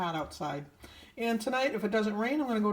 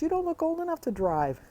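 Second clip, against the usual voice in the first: put-on higher, louder little girl voice; 11 semitones higher, 4 dB louder than usual. Neighbor's little girl talks to her from a toy car, imitated in a high child voice. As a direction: chirp it like a four-year-old girl. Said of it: "the little girl came up to me".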